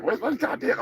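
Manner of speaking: ordinary delivery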